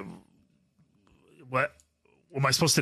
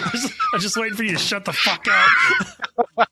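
louder mocking echo; mocking retort; 4 semitones higher, 5 dB louder, faster than usual. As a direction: throw the line back at him.

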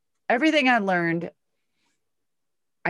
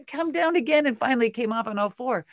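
usual speaking voice

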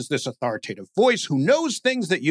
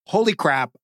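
ordinary delivery

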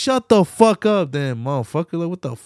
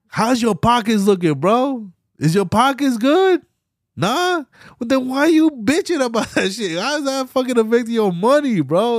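higher-pitched, incredulous grilling; indignant challenge; 7 semitones higher than usual. Real, not acted. Ranter confronting complainers about their money, indignant challenge. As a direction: press them with exasperated, raised-pitch questions.

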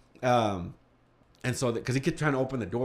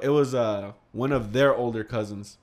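ordinary talk, nothing marked

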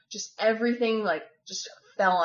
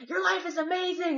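mock yelling; 9 semitones higher than usual. Host mom lecturing her crying guest, mild mock yell. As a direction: imitate a yell at a high pitch. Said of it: "she's yelling at me".

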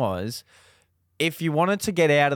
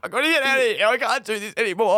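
high, strained plea to get out; scared tourist begging his mates to stop; panicked plea; 7 semitones higher, faster than usual. Retold or imitated. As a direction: beg the boys to let you out. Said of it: "I'm like".

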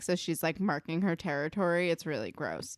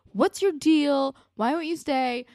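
loud, higher imitated challenge; mock confronting; 7 semitones higher, 7 dB louder than usual. Retelling Spinner chasing Marco out, mock confrontation. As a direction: demand it like a suspicious friend. Suspicious character confronts another, retold.

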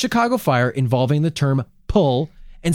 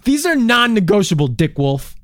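mock demand; 8 semitones higher, 5 dB louder than usual.

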